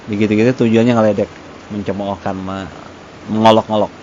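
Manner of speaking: usual speaking voice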